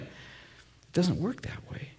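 slow, breathy whisper; a slow whispered aside. Acted. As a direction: drop to a hushed whisper to land the point. Whisper theme, point made softly.

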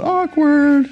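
high, drawn-out heckle; mock yell. Shown as a demonstration. Pastor showing congregation what they could yell, mock heckle. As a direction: call it out in a high singsong. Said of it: "You could yell out".

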